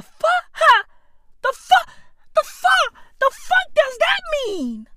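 high squeaky outraged disbelief; shrieking the fuck, stunned; shocked disbelief. Real, not acted. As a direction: pitch way up in total disbelief.

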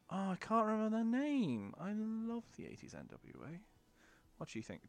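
ordinary talk

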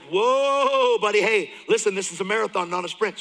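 a hint of a calming counselor, quick; counselors calming him. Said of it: "the counselors are like".

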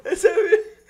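put-on high whiny baby voice, slow. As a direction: keep whining like a baby. Keeps the baby voice going.

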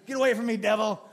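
mock rebuke. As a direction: bark it like a zealot casting out demons. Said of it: "Speak at him in tongues, in his face".

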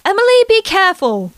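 mom fearing a fall; worried yell. Acted. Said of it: "Becca yelled".

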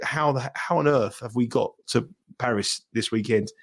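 ordinary delivery

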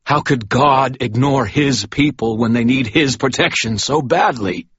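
fervent accusing priest; desperate zealot. Acted. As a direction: fight for your cause from your knees. Douglas argues back.

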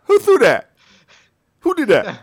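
squeaky outraged fan voice; mock heckler.

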